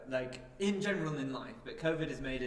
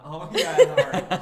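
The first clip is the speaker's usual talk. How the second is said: mock-excited, higher and louder with laughter; mock recognition; 4 semitones higher, 16 dB louder, slower than usual. Friend pretending everyone knows the person, mock recognition. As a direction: burst into laughter on the name. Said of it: "and we're like".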